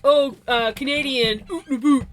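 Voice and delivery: loud mock Canadian with OOT AND BOOT. Streamer imitating people who mock Canadians, exaggerated Canadian accent. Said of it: "a lot of people are like".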